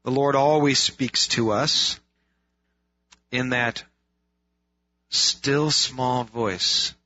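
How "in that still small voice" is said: "still" drawn out very slowly and leaned on, falling; stress on still. Shown as a demonstration. Pastor stresses "still" for the congregation, slow and drawn out. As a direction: stretch "still small voice" way out, leaning on "still". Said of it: "Emphasis on still".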